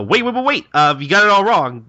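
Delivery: high, loud, rushed denial; panicked protest. Acted. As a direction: cut them off in a frantic panic. Gintoki denies being afraid.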